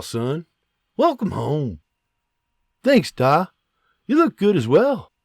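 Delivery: hearty Scots farmer, teasing his son; a proud da with a Scots lilt, joking; jolly Scottish father. Acted.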